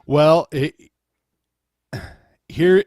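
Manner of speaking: normal talking voice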